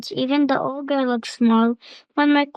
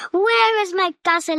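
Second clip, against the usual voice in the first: higher, insistent ogre cry; angry ogre repeat; 6 semitones higher than usual. A narrator's reading. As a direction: hammer the question like a furious ogre. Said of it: "he repeated".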